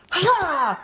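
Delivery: high, drawn-out "ha"; imitated strike cry. Wife retelling striking husband in her sleep, imitated fighting cry. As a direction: act out a sudden chop with a cry. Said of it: "turned around and went".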